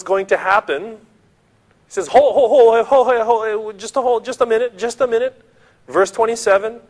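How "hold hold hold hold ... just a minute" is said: high, rapid-fire urgent plea; urgent interruption. Acted. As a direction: burst in to stop someone. Acts Paul stopping a suicide.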